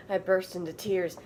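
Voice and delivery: normal talking voice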